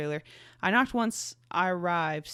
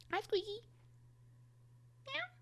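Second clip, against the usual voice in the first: very high, soft squeaky coo; 14 dB quieter than usual. Real, not acted. Owner cooing at her little cat in baby talk. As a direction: coo tenderly at the kitten.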